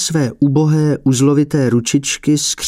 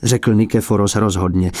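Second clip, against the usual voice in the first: ordinary delivery, nothing marked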